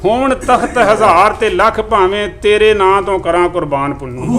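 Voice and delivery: measured, even recital of verse; poem recitation. Acted. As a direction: recite each line like a mushaira couplet. Recites Punjabi poem to audience.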